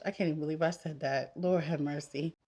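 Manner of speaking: ordinary talk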